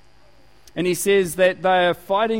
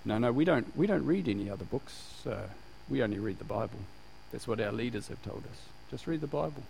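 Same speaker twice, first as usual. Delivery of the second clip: quiet rote reply; 6 semitones lower, 10 dB quieter than usual. Retold or imitated. Group reciting leaders' rule.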